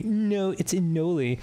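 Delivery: a hint of a snooty know-it-all; smug correction. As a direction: correct him with light smugness. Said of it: "someone douchily correct me".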